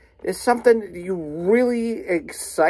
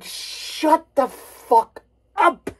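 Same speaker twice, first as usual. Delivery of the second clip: fed-up retort; 7 semitones higher, slower than usual. Retold or imitated.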